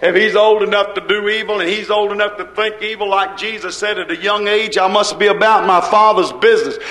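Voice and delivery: ordinary talk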